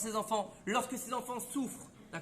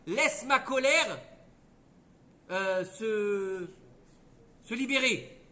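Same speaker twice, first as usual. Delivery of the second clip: angry decree; 7 dB louder, much slower than usual. Acted.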